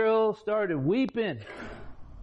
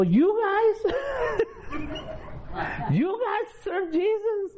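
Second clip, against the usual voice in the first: very high, laughing disbelief; astonished biker; slower than usual. Retold or imitated. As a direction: squeal the question in amazed disbelief. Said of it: "they go".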